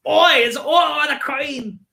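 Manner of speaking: high, loud hammed-up British accent; yelling in an exaggerated British accent; shouted mock British. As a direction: bellow it like an outraged Cockney.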